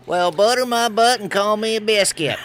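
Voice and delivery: put-on Southern hillbilly accent done playfully. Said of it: "in your best hillbilly voice".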